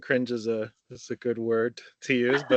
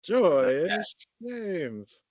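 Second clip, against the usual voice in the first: high, swooping, drawn-out name call; playfully hammed-up delight; joyous; 7 semitones higher than usual. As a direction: sing it out with exaggerated joy. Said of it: "passing the emotion that goes to it".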